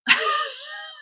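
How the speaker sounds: a sudden high shriek of a laugh. Real, not acted. Laughing in disbelief at what the partner said, high shrieking laugh. Incredulous about abusive partner.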